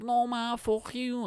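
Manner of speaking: put-on cheeky child talking back in high Scouse; mock Scouse brat; Scouse kid. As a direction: put on a high Scouse accent and defy mum. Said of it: "I don't usually do Scouse accent".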